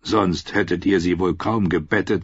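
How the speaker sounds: usual speaking voice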